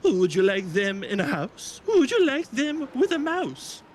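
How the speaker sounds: hammed-up storybook question; playful storybook question. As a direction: stretch the rhyme up high and teasing. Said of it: "Doctor Seuss read a book".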